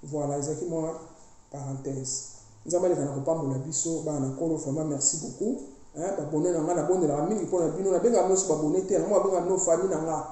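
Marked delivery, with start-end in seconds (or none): none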